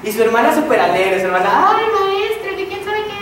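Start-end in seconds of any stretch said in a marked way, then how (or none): 1.7-3.1 put-on much higher, gushing girl's voice; high sing-song "Ay, maestra"; gushing little sister. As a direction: squeal it like an excited kid to her teacher. Voicing the boy's happy sister.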